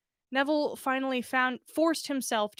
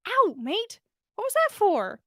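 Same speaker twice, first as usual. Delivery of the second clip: sudden pained ow, pitched high and louder; startled yelp; 9 semitones higher, 5 dB louder than usual. Acted. Neville pecked by an owl, startled high cry. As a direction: yelp as a bird pecks you. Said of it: "he asked".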